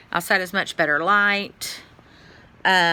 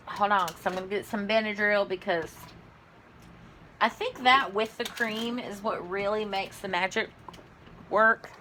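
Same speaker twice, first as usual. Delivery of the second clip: ordinary talk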